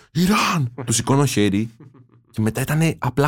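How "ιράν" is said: high mock-angry yell; retold holler with little real volume; imitated irritated shout. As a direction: mimic an annoyed street shouter, pitched right up. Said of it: "εκνευρισμένος".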